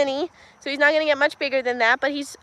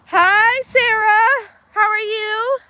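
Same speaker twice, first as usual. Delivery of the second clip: bright greeting; 7 semitones higher, much slower than usual. Real, not acted. Greeting a viewer by name.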